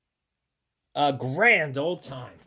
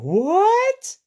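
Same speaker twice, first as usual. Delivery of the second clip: high, loud rising squawk; startled cat; 7 dB louder than usual. Acted. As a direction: shoot up in shocked disbelief. Voices cat's reaction in picture.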